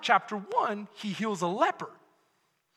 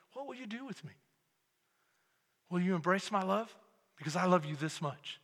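much softer, pleading and intimate; hushed plea from the cross; 3 semitones lower, 7 dB quieter, faster than usual. Acted. Preacher voicing Jesus on the cross, hushed and pleading. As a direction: let the question hang, quiet and aching.

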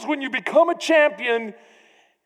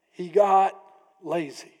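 usual speaking voice

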